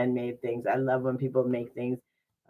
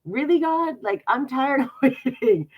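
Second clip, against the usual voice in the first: high, loud, exasperated complaint; exasperated with God; 10 semitones higher, 5 dB louder than usual. Retold or imitated. Whining up at God about waiting, exasperated. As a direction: throw up your hands at God, laughing. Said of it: "And I would be like".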